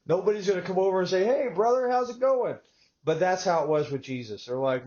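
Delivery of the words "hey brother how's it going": higher, chummy hey-brother hello; friendly bar greeting. Retold or imitated. Bar regular welcoming a stranger from Texas, higher friendly hello. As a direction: greet a stranger like an old buddy.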